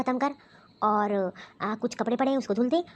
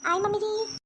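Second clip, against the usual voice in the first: put-on young daughter-in-law; 6 semitones higher than usual.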